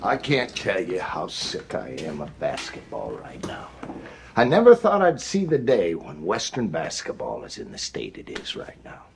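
low, weary disgust; halftime pep speech. Real, not acted. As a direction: sink into despair. Coach deflated, low.

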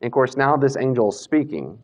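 usual speaking voice

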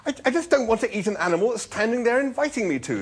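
high, loud, stammering protest; distressed objection. A narrator's reading. Arthur refusing the inviting animal, distressed. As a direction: stumble into a high-pitched objection.

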